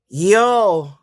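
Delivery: ordinary talk, nothing marked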